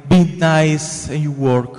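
normal talking voice

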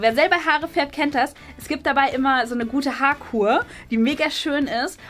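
usual speaking voice

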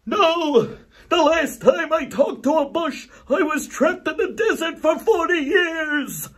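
high, loud yelled punchline; hammed-up high shout; Moses yelling. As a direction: yell it back over your shoulder at him. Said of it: "Moses yells over his shoulder".

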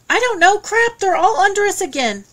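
put-on boy panicking underwater; frightened. A narrator's reading. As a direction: voice tight with fear of the sharks. Shane panicking among sharks.

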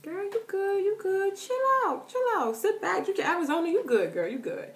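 high-pitched, playful reassurance; high sing-out to a girlfriend; playful calming-down. Acted. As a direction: coo it high, telling a friend to chill. Playfully telling viewer to calm down.

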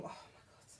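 hushed awed whisper; whispered aside. Real, not acted. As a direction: whisper it to yourself, overcome. Overcome by cute fox story.

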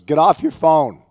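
loud high order from another room; bossy daughter yell. Retold or imitated. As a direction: bark it like a bossy little girl. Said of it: "She goes".

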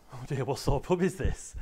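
hammed-up shock at the pub; mock dismay. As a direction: play up the puzzled customer for the room. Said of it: "is just sat there going".

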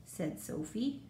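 usual speaking voice

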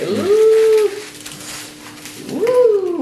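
high excited ooh. Real, not acted. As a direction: squeal ooh with delight. Excited at grilled cheese.

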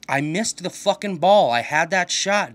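loud, slow frustrated yelling. Real, not acted. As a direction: yell it out in frustration. Missed shot, yelling in frustration.

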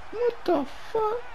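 high, hushed, drawn-out; stunned disbelief. Real, not acted. Gamer ignored by his quarterback, stunned disbelief. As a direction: squeak it out slowly in disbelief.

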